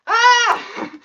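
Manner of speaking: sudden high scream of fright; retold scream. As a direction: shriek it like you just saw a giant spider. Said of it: "And I'm like".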